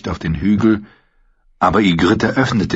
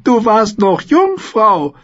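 hoch und sehr langsam gedehnt, spöttisch; neckend; 4 dB louder, slower than usual. A narrator's reading. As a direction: zieh ihn genüsslich auf. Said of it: "neckte sie ihn". (neckend, hoch und gedehnt)

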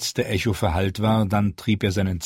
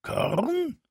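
very high rasping squawk with a rising question; croaking raven; 4 dB quieter than usual. Acted. The raven echoes the crowd, high and squawking. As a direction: caw it like a curious bird.

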